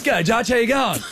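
booming high cheery hello; loud greeting shout. Retold or imitated. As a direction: shout the greeting across the room.

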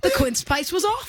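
put-on high New Zealand accent; Kiwi accent. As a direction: say it with a Kiwi twang. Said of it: "You put on a Kiwi accent for that".

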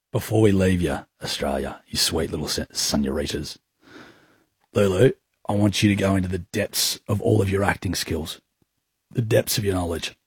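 low, soft, solemn director's voice; quiet, low drama-coach build-up; hushed scene setting. Acted. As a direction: hush the room and set the scene. Sets up an acting scene.

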